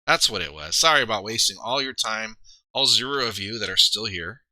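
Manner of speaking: usual speaking voice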